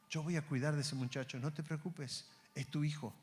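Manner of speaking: calm him down softly, as God; God's reassurance. Acted. God promising Abraham his son is safe, soft and tender.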